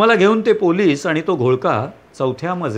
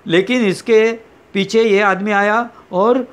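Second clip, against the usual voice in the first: usual speaking voice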